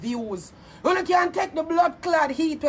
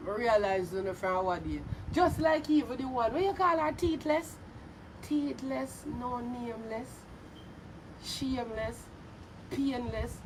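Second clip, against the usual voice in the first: quiet muttering; 4 semitones lower, 10 dB quieter than usual. Acted. Muttered list of insults.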